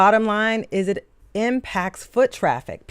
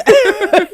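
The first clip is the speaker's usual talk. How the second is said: burst of laughter; 13 semitones higher, 7 dB louder than usual. Real, not acted. Laughing at the water ice clip.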